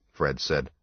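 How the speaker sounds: ordinary delivery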